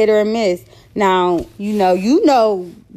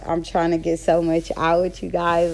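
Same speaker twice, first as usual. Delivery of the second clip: usual speaking voice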